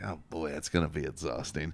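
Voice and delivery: soft weary dread; softly dreading it. Retold or imitated. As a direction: sigh it out tiredly. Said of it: "I'm like".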